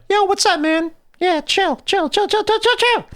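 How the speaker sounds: put-on very high, squeaky cool-kid chatter; little kid self. Imitating himself as a little kid, high kid voice. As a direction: put on a tiny kid acting laid-back. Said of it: "me as a little kid was like".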